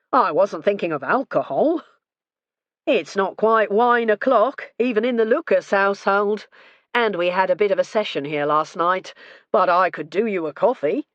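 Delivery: easygoing husband teasing about drink; lightly voiced jovial host. A narrator's reading. Retired husband joking with the inspector, lightly jovial. Lucas jokes about wine o'clock.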